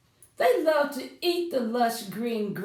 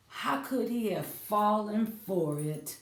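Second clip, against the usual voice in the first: ordinary talk, nothing marked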